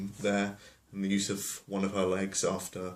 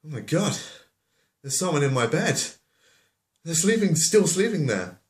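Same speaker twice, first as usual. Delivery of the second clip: high, loud, hammed-up bear alarm; Baby Bear panicking; 8 semitones higher, 7 dB louder than usual. Baby Bear finding someone in the bed, loud high panic. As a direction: shout it high, in a mock panic. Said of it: "Then Baby Bear goes".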